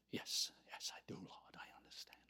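hushed, submissive whispered assent; meek whisper. Acted. Moses accepting the Lord's condition, meek whisper. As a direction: whisper it, fading to almost nothing. Moses meekly answers the Lord.

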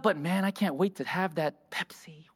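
a guilty whispered aside; sneaky whisper. Pretend. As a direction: let the real wish slip out in a whisper. Secret aside after mock prayer.

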